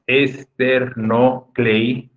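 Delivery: "Esternoclei" as es, ter, no, clei with gaps; very slow, separated syllables in "Esternoclei". Shown as a demonstration. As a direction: count out "Esternoclei" one syllable at a time. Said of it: "Se divide en nueve sílabas".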